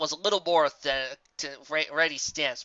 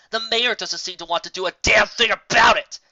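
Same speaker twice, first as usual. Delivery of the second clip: fuming; 6 semitones higher, 9 dB louder than usual. Acted. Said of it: "fumed the Pegasus".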